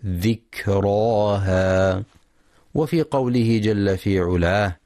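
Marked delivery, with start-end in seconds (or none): 0.1-2.0 ذكراها with a voiceless, firmly stopped kaf, very slow; crisp kaf. Shown as a demonstration. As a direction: hit the kaf of ذكراها hard and breathy. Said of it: "هكذا".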